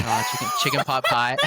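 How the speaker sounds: high, laugh-filled imitation of a client; giggling through the quoted reply; laughing retelling. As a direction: quote the client while cracking up. Cracking up while quoting the client.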